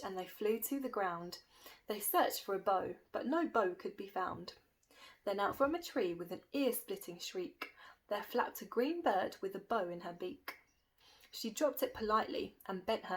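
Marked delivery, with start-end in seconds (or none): none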